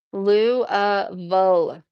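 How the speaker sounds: Lou, uh, Vul as three separate beats; correcting pronunciation. Shown as a demonstration. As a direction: spell out Lou, uh, Vul by sound, firmly. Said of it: "you need to pronounce it better".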